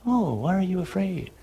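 soft, serene question, far too calm; mock gentle. Jesus as he would not sound to panicked disciples, mock gentle. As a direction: murmur it softly as a parody of calm. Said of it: "He doesn't say".

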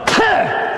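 spitting sound Puh; preacher mimicking spitting at the cross; mock spit. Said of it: "and spit on him".

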